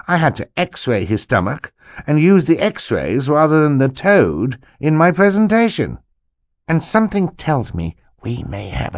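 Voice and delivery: animated, mostly high uncle voice; self-important uncle. Acted. Uncle warns children about jealous people, self-important. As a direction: tell the grievance with theatrical indignation. Monty tells tale of jealousy.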